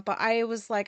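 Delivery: ordinary talk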